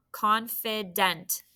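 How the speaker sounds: "Confident" slowly, ending "dent" clear; slow syllable demo. Shown as a demonstration. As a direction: drag the word out for the class. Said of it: "what about the last syllable".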